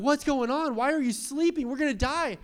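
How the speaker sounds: high, loud mock panic; panicked. Disciples in the storm, high panicked shouting. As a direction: disciples yelling over the storm. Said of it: "They're like".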